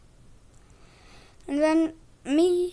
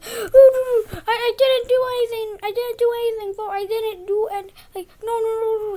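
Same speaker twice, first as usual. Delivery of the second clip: high, loud, whining denial; mock whiny protest; 9 semitones higher, 7 dB louder than usual. Boy mimics Jack denying it to the teacher, high whine. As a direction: play the kid pleading his innocence, whiny. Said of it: "go super crazy and mad at us".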